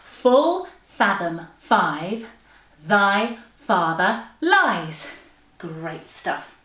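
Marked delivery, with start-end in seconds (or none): 0.0-5.1 very slow, separated, swooping words; tongue-twister demo. Shown as a demonstration. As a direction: articulate each word by itself with big intonation. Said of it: "And one more time".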